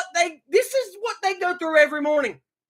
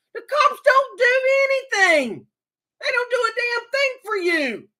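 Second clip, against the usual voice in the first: anguished shout; 8 semitones higher than usual. Real, not acted. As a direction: cry it out in frustration. Ranting, cry shout heard.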